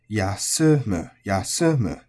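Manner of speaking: Yasumu slow, pitch peak on su, then falling; accented yasumu. Shown as a demonstration. Teacher demonstrating accented Yasumu to learners. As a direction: drop after the su in Yasumu.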